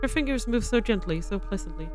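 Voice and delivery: soft, even storyteller voice; story narration. A narrator's reading. Narrator telling of the beast and the girl, gentle narration. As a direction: read it like a gentle fairy tale.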